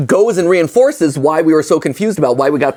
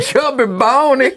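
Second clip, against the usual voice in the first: cheeks stuffed full; 6 semitones higher, slower than usual. Real, not acted. Mouth stuffed with marshmallows.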